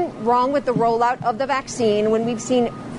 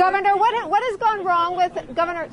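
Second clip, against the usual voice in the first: higher, insistent push to get the question in; pressing interruption; 6 semitones higher than usual. Real, not acted. Reporter pressing Governor DeSantis over his interruptions, higher and insistent. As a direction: talk over him to force the question out.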